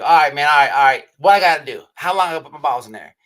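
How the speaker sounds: loud, urgent mock agreement; loud, all in. Inmate agreeing to the dare for dip, loud and urgent. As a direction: give in loudly, imitating a desperate man.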